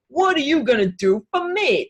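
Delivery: hammed-up tough guy wanting payback. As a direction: demand it like a cocky tough guy. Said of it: "gonna do that tough guy accent".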